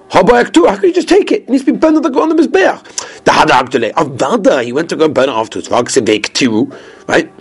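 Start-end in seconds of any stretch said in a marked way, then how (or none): 0.1-2.8 much higher, protesting question; incredulous objection. Acted. Teacher objecting to taking the offering's kidneys, incredulous objection. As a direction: object in a high, indignant voice.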